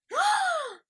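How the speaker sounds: high, loud theatrical gasp; mock gasp. Telling her dog about under-hangers, hammed-up gasp. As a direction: gasp in staged horror.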